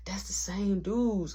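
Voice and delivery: slow hushed whisper. Retold or imitated. Telling the coworker about the men, in a slow whisper. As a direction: whisper it so nobody else hears.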